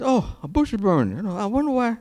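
high-pitched, wondering Moses; curious Moses. Acted. Preacher acts out Moses noticing the burning bush, high and wondering. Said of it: "And Moses said".

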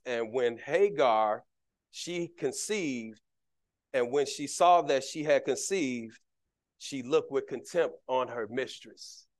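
low, soft, slow reading of the verse; soft Bible reading; scripture reading. Acted. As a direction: read the text quietly and deliberately.

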